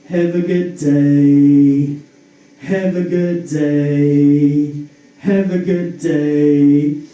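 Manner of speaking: low, slow, loud monotone drone; droning coworker. Retold or imitated. Coworker to every customer, slow low drone. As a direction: drag it out like a bored worker. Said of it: "he'd be like".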